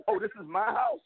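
a sneering mock of the boast, rising at the end; mocking the taunt. Scoffing at the rival's claim on the home field, mock sneer. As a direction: scoff at it in disbelief. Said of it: "you're coming into my house and you're saying".

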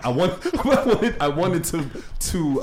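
high, loud, laughing restarts. Real, not acted. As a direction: crack up while trying to get the words out. Cracks up while telling the story.

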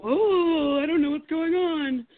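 high, whiny, playing dumb and scared; mock clueless whimper. Mocking the girlfriend who pretends not to understand, whiny. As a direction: imitate her fake helplessness. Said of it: "acting like".

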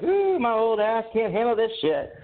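lightly put-on complaining old-gal voice; imitating the neighbor. Neighbor grumbling about the steps to a passer-by, imitated high-pitched complaint. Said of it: "she was just like".

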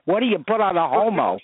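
to the sandwich guy, puzzled; puzzled question. Retold or imitated.

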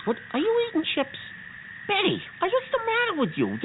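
high-pitched, incredulous telling-off; exasperated scolding. Acted. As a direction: squeak upward in disbelief at a friend. Catches Betty eating chips on mission.

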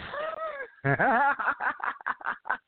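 howling laugh at the joke. Real, not acted. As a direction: crack up with a shriek. Big laugh.

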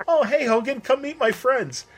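high, slowed-down impression of a pal; imitated cheery introduction; friendly greeting. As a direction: call a friend over in a high voice.

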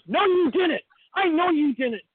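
high-pitched mock scolding; imitated insistent mom. Mom rejecting her child's false confession, mock insistent. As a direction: act out a mom cutting off an argument.

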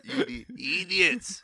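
drawn-out, high mocking cry through laughter; mock cartoon insult. Mocking drug users with cartoon insult.

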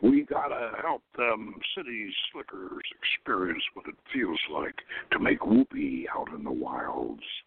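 a laconic cowboy who barely bothers speaking; low, whispered laconic cowboy telling the secretary the plan; Gary Cooper drawl. Acted. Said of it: "Gary Cooperish laconism".

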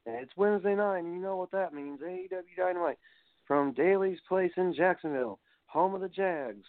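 lightly imitating a hyped play-by-play call; Jim Ross. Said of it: "said Jim Ross".

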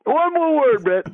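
very high-pitched irate coach imitation; Coach Sutton. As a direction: cut the player off with a high warning. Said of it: "coach is hot".

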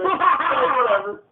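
slow, loud laughing whatever; drawn out through laughter; laughing shout. Real, not acted.

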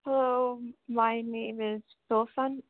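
usual speaking voice

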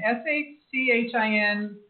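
letters S H C H I N said one by one; spelling it out. Shown as a demonstration.

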